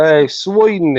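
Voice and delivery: slow, drawn-out mock Australian; playful Australian accent. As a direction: stretch the vowels in put-on Aussie. Carries on the mock accent game.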